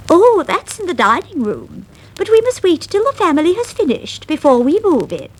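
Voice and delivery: high, fast mouse voice; Lucy's voice. Acted. Lucy explaining to Miranda, high and fast. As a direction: explain briskly, high and quick.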